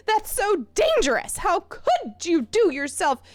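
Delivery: high-pitched, alarmed scolding; shrill admonishment. Acted. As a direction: scold them for the danger, voice up high. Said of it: "I was aiming for stern admonishment".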